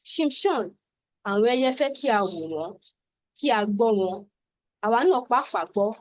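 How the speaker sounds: lively, quick Yoruba poem reading. Acted. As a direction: read the poem aloud with rhythm and drive. Reads her Yoruba poem aloud.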